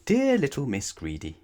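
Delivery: letter voice. Acted. Reading Mister Greedy's letter.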